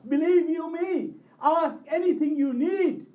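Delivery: high, loud, urgent preaching cry; impassioned exhortation. Real, not acted. Preacher urging the congregation to ask Abbas, impassioned shout. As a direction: exhort them at full force.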